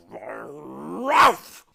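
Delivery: loud barking cry; dog's exclamation. Acted. Watchdog arriving at Milo's car, barking loud. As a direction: bark it like a dog. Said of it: "exclaimed the watchdog".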